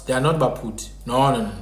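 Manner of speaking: usual speaking voice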